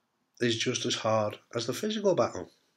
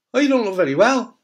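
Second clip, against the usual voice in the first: high, loud mock-pitying shopper; concerned shopper; 10 semitones higher, 8 dB louder than usual. Shopper greeting a chemo patient with loud concern. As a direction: act out the shop worker's shocked concern. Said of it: "a lot of them go".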